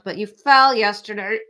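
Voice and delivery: usual speaking voice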